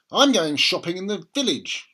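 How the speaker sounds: busy mum heading out the door; mother's voice. A narrator's reading. Mother voiced in read story.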